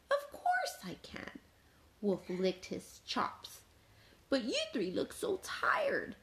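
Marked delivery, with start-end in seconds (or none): 0.1-1.4 sly, whispery agreement; hushed false helpfulness; scheming wolf. A narrator's reading. Wolf pretends to help. 4.3-6.1 high, whispery cooing; wolf sweet-talking prey; falsely concerned wolf. A narrator's reading.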